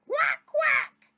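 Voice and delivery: very high, loud "Quack, quack". Acted. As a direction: honk it like an annoyed duck. Duck quacks again.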